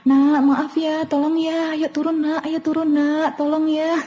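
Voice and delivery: hammed-up pleading to a stubborn child; over-gentle pleading mother. Shown as a demonstration.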